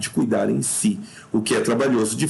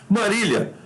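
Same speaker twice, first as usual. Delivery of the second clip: raised pitch, summoning someone by name; calling out; 8 semitones higher than usual. Shown as a demonstration. Teacher demonstrates a vocative, calling out to Marília. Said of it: "tá chamando".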